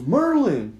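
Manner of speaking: acted stranger's shout; calling out. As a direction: bellow a name through a door. Stranger calls through the door.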